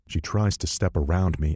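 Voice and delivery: plain reading voice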